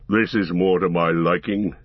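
low, heavy dwarf voice; gruff gravelly dwarf voice. Acted. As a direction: growl it out like a stout dwarf. Gimli the dwarf speaks.

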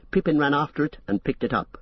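ordinary delivery

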